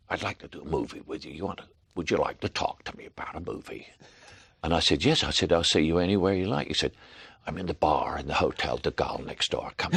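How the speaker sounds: put-on quiet, breathy old Huston murmur; hushed John Huston voice on the phone; John Huston impression. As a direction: invite him softly, Huston style. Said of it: "That sounds like John Huston".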